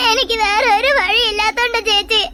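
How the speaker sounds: begging through tears, voice wet with crying; crying plea. Acted. Girl begs sister, crying.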